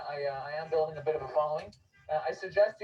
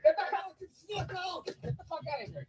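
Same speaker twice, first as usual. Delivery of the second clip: much higher yelling, half laughing; angry yell; 10 semitones higher than usual. Real, not acted. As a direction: shout him off while laughing.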